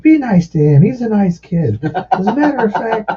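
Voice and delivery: high-pitched appeal to a crowd; imitated stage call-out. Singer tells crowd to go easy on kid, calling out. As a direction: project it high, like a singer at the mic.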